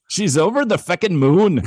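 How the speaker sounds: high, loud mock Irish lilt; hammed-up Irish delight; attempted Irish accent. Shown as a demonstration. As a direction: belt it out in a put-on Irish accent. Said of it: "I should do it again".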